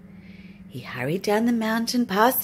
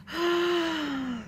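shocked gasp; 6 semitones higher, 4 dB quieter than usual. Acted. Wave hits sleeping Bear.

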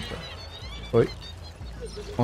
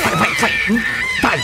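very high, urgent yell of go; excited shout. Real, not acted. Gamer yelling during a chase, excited. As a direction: urge yourself on in a high yell.

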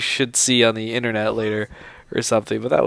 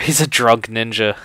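normal talking voice

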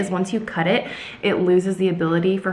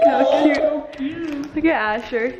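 cooing; 7 semitones higher, much slower than usual. Real, not acted. Parents cooing at sleeping baby.

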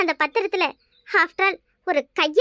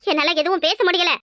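ordinary talk, nothing marked

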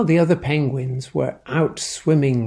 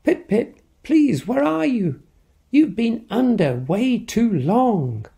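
worried cry; 8 semitones higher than usual. Acted. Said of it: "Then she cried out".

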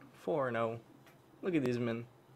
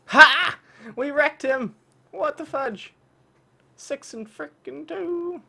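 high, loud triumphant cheer; victory shout; 9 semitones higher, 10 dB louder, slower than usual. Real, not acted. Gamer celebrating winning the match. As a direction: celebrate the win at full volume.

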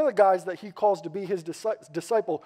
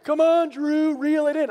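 higher, louder teasing call; heckling; 7 semitones higher, 4 dB louder than usual. Retold or imitated. Brother-in-law heckling Drew at the reel, higher teasing call. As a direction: egg him on while he struggles. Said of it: "He's literally heckling me".

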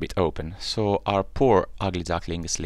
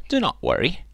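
much higher, timid duckling; 11 semitones higher, 4 dB louder than usual. Acted. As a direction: reassure them, small and shy. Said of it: "he said to them".

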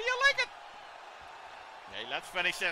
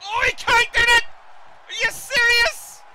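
shocked shout; 12 semitones higher, 6 dB louder, slower than usual. Real, not acted. Player shocked by game counter.

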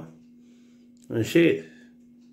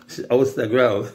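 ordinary delivery

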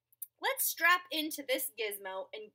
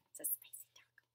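whisper; 26 dB quieter, much faster than usual. Acted.

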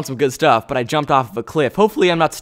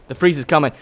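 normal talking voice

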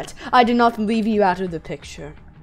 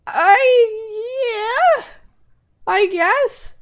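shaky sad; 11 semitones higher, much slower than usual. Acted. Voicing sad character.

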